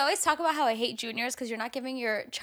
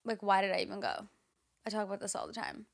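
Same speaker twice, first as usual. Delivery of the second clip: ordinary talk, nothing marked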